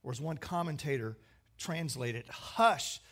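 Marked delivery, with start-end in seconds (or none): 2.3-3.0 raised, forceful order to the storm; commanding rebuke. Acted. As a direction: command it loudly, pitched up high. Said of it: "Jesus rebuked the storm".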